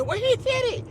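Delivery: very high, squeaky naysayer voice; high-pitched mock disbelief; mocked doubters. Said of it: "people say".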